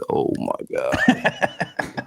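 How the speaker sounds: slow, breathless whispered disbelief; exasperated whisper. Retold or imitated. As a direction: drag it out, barely voiced, in disbelief. Dad brings up Toy Story.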